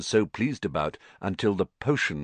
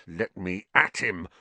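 growled. A narrator's reading. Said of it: "Ron growled".

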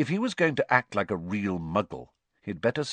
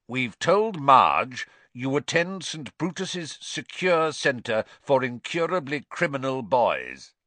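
slow, raised stern uncle voice; 6 semitones higher, 4 dB louder than usual. A narrator's reading. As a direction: lay down the lie slowly, high and firm. Vernon announcing the cover story.

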